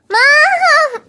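usual speaking voice

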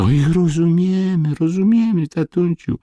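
put-on very high, wailing child voice; sobbing boys. A narrator's reading. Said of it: "szlochali obaj chłopcy". (szlochające dzieci, bardzo wysokim głosem)

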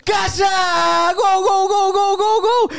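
long rising shouted goal cry; explosive goal commentary. Pretend. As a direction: scream GOL over and over. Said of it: "Até os jargões usando". (grito de gol explosivo)

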